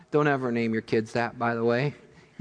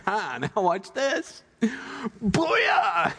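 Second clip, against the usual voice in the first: triumphant boast; 7 semitones higher, much slower than usual. Retold or imitated. Elijah before the fire falls.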